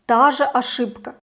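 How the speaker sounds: ordinary talk, nothing marked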